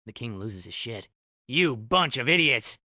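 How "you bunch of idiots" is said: loud, slow, drawn-out yell; enraged scream. A narrator's reading. King screaming at his useless goons, enraged. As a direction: lose it and bellow slowly at them. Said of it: "he screams".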